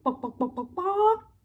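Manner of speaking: rising hen clucks; hen clucking. Acted. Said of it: "And the hen said".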